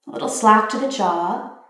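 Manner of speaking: ordinary talk, nothing marked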